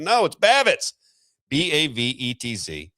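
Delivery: "Babbitts" said high, then B A V E T Z spelled slowly; spelled-out correction. Shown as a demonstration. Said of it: "I said".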